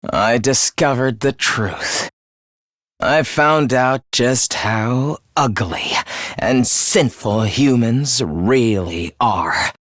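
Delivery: low, deliberate denunciation; low-pitched, weighty disgust; dark contempt. Acted. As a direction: spell out humanity's sins with low loathing. Villain denounces humanity.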